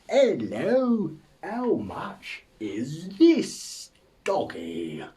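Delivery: high, loud, slow and sing-song fake English accent; mock English accent. French visitor asking a price, absurd mock English accent. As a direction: badly overdo an English accent. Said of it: "You don't get the French coming over here".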